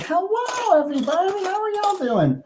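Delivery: very high cutesy pet voice; squeaky high voice for the animal; voicing the pet. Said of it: "he's like".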